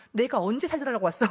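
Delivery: indignant retort. Retold or imitated. Newcomer silently answering the pastor's call to prayer, indignant. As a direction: fire back a retold inner protest. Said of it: "이런 마음이었어요".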